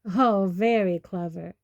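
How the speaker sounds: loud, grumpy growl. A narrator's reading. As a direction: growl it with heavy sarcasm. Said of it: "growled Hagrid".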